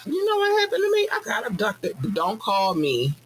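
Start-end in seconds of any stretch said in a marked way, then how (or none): 0.1-2.0 much higher, breathless mock panic; panicked abductee. A friend calling after alien abduction, in a high mock panic. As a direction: squeak it like a freaked-out friend. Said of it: "call me and be like".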